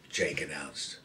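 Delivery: ordinary delivery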